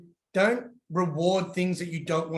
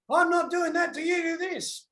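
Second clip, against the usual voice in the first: put-on much higher, whiny kid bargaining; bratty child voice; 12 semitones higher than usual. Dad mimicking a child bargaining, high and whiny. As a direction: play the demanding little negotiator. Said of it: "Children are like terrorists".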